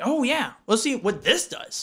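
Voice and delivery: high, eager goofy voice; excited caveman voice. Retold or imitated. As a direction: sound thrilled to try anything. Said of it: "You were like".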